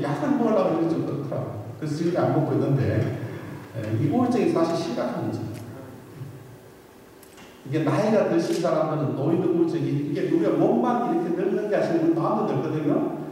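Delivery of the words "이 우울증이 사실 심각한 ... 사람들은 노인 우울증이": ordinary delivery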